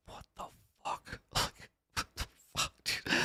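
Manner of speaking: near-silent breathy disbelief; hushed what the fuck under a laugh; stunned whisper. Retold or imitated. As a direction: barely voice the shock, then a laugh. Stunned reaction to ten grand.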